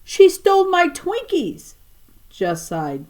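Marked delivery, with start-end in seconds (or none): 0.0-1.7 shrill, very high wail of outrage; a first grader shrieking her complaint; screaming. Acted. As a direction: scream it like a robbed little girl. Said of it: "come screaming toward them".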